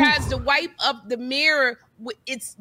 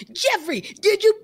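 much higher nagging-mom voice, a bit louder; mimicking nagging mom; 9 semitones higher, 3 dB louder than usual. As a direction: whine the questions like a nagging mother. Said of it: "she always says".

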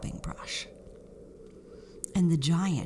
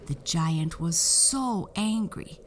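ordinary delivery

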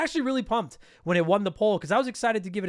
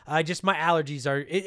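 usual speaking voice

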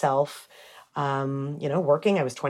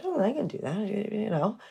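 soft, musing aside; quiet musing to herself; 3 semitones higher, 9 dB quieter than usual. Retold or imitated. Thinking to herself at the store.

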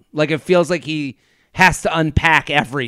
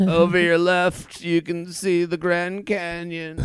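slow, smooth captain announcement; slow cabin announcement, fading away; airline pilot voice; 5 dB quieter, slower than usual. Retold or imitated. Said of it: "He sounds like an airline pilot".

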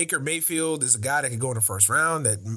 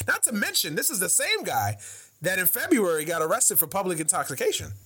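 ordinary delivery

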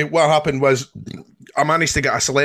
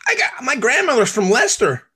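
high-pitched American tourist voice; mock American accent; 9 semitones higher than usual. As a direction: imitate an eager Yank, pitched up. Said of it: "He's one of the Yanks that".

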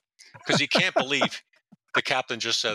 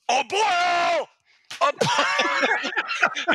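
high, loud drawn-out yell; imitated bellow; 4 dB louder, much slower than usual. Captain yelling for the prankster detective, mock bellow. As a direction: holler like an enraged boss.

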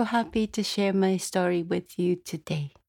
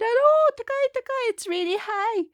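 much higher, louder gasp of fright; scared of the height; 14 semitones higher, 3 dB louder than usual. Acted. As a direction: cry out at the dizzy height. Old man on high bridge.

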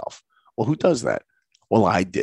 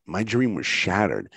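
ordinary talk

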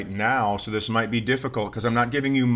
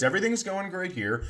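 normal talking voice

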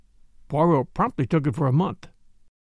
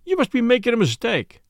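higher-pitched disbelief; amazed protest; 7 semitones higher than usual. A narrator's reading. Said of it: "I started forward in amazement".

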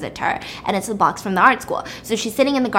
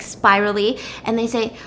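usual speaking voice

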